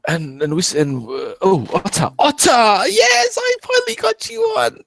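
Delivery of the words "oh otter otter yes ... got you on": much higher, thrilled cry; high excited welcome; excited greeting. Real, not acted. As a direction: burst out delighted at a guest finally arriving. Greets a long-awaited guest joining.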